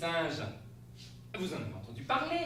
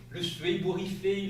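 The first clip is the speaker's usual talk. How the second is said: ordinary delivery, nothing marked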